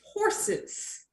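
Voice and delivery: breathy mystic fortune teller whisper; psychic voice. Acted. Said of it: "psychic voice".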